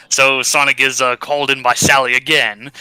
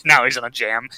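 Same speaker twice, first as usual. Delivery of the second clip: ordinary talk, nothing marked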